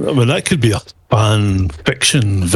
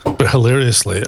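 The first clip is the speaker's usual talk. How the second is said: ordinary talk, nothing marked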